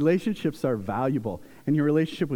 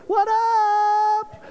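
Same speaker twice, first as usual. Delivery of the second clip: very high, loud drawn-out call; hammed-up dad shout; goofy holler; 5 dB louder than usual. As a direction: holler it comically through a window. Pounding on window, calling to daughter.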